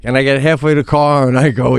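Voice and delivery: ordinary talk, nothing marked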